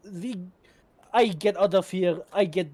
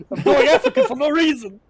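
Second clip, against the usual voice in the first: laughing loudly mid-sentence; 11 semitones higher, 10 dB louder than usual. Real, not acted. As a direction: blurt it out through a big laugh. Laughs at own accent slip.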